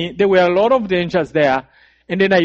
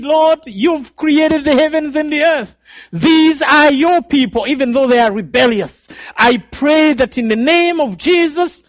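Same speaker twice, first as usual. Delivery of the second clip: fervent prayer; 8 semitones higher than usual. Retold or imitated. Young man praying among killers, high and fervent. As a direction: cry out to the Lord in urgent faith. Prayer retold in preaching fervor.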